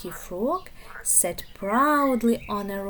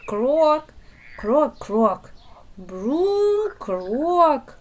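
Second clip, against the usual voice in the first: loud, croaky frog calls; frog croaks; 4 semitones higher, 5 dB louder, much slower than usual. Acted.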